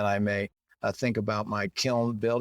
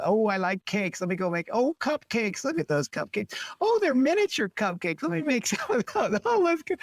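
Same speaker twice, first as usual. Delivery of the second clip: high-pitched mock excitement, climbing higher and higher; giddy distracted baker; 10 semitones higher than usual. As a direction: play someone gushing over each new idea, breaking into a laugh.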